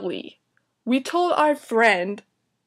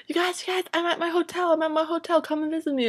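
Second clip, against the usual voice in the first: high, insistent put-on voice; mock an eager friend calling, pitched high; Christy; 7 semitones higher than usual. Friend phoning to invite them to her hotel, high mock voice. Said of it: "Christy called us and she's like".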